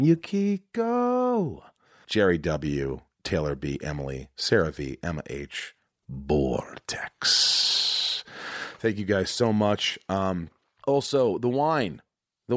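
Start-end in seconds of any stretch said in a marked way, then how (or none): 0.0-1.6 Yukiko sung out high and very slow, drawn long; savoring the name. Shown as a demonstration. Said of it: "That's such a great name". 7.0-8.2 whispered name. Real, not acted. Last patron name whispered.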